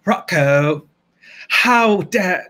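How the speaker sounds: affected, drawn-out diva indignation; Moira Rose. Acted. Botched impression for viewers, affected diva voice. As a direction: stretch it out theatrically, then break off. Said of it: "I could do it really well".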